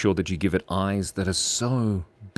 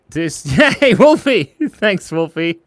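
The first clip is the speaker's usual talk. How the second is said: high, loud cheer with a laugh; excited, laughing welcome; delighted greeting; 10 semitones higher, 9 dB louder than usual. Real, not acted. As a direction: cheer a friend's arrival, pitched high. Greets an arriving viewer, delighted.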